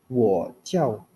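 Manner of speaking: WO only dips down, no lift; half third tone. Shown as a demonstration. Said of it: "wo-nya itu cuma turun doang".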